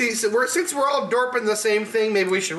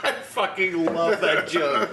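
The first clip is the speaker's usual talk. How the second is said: words through laughter; laughing; slower than usual. Real, not acted. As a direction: still laughing as you speak. Laughing at the joke.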